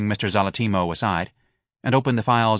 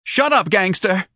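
high, loud, drawn-out yell; frantic high-pitched shout; wild retort; 11 semitones higher, 5 dB louder, slower than usual. A narrator's reading. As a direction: lash out wildly at him. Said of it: "Missus Levy answered wildly".

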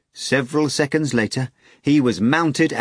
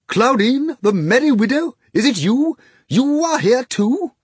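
put-on high, loud drunk delight; joyous drunken bellow; 14 semitones higher, 5 dB louder than usual. A narrator's reading. The drunk fat man greets Margarita, drunken bellow. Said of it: "bellowed joyously".

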